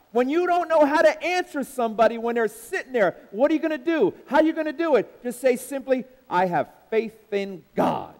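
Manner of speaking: loud rhythmic preacher cadence; preaching. Acted. Preacher rousing the congregation, loud and rhythmic. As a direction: preach it out to the church, full force.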